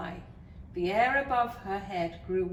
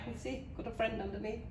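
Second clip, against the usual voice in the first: ordinary delivery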